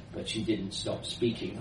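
ordinary delivery, nothing marked

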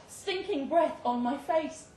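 usual speaking voice

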